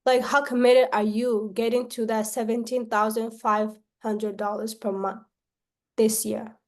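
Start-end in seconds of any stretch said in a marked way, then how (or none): none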